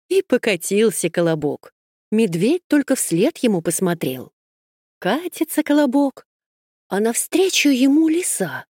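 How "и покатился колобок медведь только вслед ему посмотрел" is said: plain reading voice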